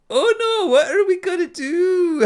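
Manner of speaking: very high, slowed, hammed-up Scottish wail; Scottish panic. Imitating the players' reaction to the massacre in a Scottish accent. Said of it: "And you're like".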